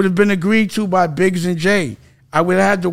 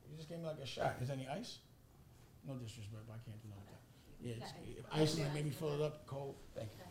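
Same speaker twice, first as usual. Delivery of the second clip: turned away from the mic, far off and much softer; off-mic aside; 7 semitones lower, 28 dB quieter, faster than usual. Real, not acted. Thanking the server for the drink, spoken far from the mic. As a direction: talk away from the microphone to someone else.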